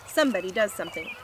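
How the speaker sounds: usual speaking voice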